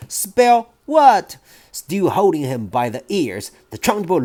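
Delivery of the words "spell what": put-on higher, louder boy squeal. Boy squeals to headmistress, higher louder. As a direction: squeal it out desperately.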